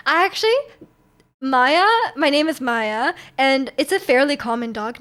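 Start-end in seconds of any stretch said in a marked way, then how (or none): none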